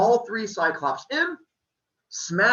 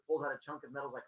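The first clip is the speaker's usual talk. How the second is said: ordinary delivery, nothing marked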